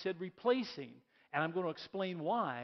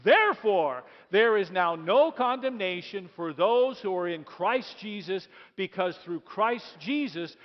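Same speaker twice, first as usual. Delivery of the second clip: proclaiming the verse; 8 dB louder, slower than usual. Shown as a demonstration. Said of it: "read it one more time".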